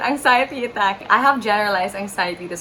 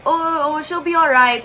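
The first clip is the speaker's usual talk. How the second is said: higher-pitched imitation of an aunt; reassuring; 7 semitones higher, 4 dB louder than usual. Aunt telling the stranger her niece is fine, reassuring. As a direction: soothe it away in a raised voice.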